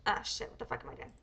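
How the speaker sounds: low, unvoiced mumble under the breath; muttering to self. Real, not acted. Streamer cursing own slip while typing, muttered to self. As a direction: swear at yourself, barely audible.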